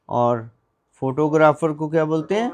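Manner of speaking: ordinary talk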